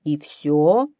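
loud, incredulous lion; lion's question. A narrator's reading. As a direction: ask it as the commanding lion. (лев громко переспрашивает)

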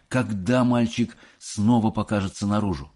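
usual speaking voice

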